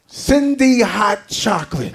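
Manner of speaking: slow, drawn-out announcer shout; hyped-up stage introduction; showman intro. Real, not acted. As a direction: bellow the name like a ring announcer. Introducing puppet to the crowd.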